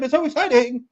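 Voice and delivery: a hint of an overexcited announcer; Excalibur impression. Co-hosts mocking the announcer Excalibur, lightly hyped. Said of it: "who sounds like this all the time".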